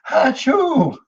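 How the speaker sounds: big high-pitched mock sneeze; fake sneeze. Speaker urging the audience to get cold for Allah's love, fake sneeze.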